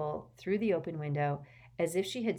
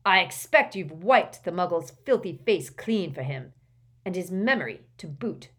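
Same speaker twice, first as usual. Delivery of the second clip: defiant; 2 semitones higher, 9 dB louder than usual. Acted. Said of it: "Gaunt said defiantly to Ogden".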